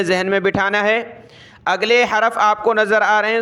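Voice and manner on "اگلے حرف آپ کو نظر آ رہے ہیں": usual speaking voice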